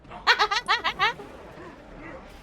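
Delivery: bursting out laughing; real laugh at the joke. Real, not acted. Laughing at friend's impression.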